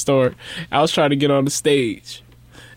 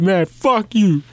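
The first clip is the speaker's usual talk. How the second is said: slow, higher mock-angry dismissal; imitating drunk outburst; 6 semitones higher, slower than usual. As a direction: drag it out like a belligerent drunk friend. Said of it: "he bipolar as hell".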